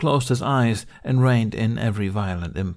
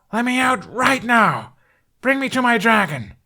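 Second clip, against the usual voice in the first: furious yell; 13 semitones higher, 5 dB louder than usual. Acted. Hiccup yelling at captors to free him. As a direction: scream it at the door.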